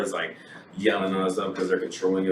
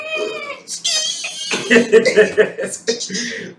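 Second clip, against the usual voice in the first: high, loud burst of laughter; shrieking laugh; 6 dB louder than usual. Real, not acted. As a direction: crack up hard. Big laugh.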